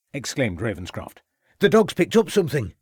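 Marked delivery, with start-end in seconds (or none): 1.6-2.7 high, louder urgent call; quick excited call. A narrator's reading. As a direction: alert the group eagerly. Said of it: "said Catherwood".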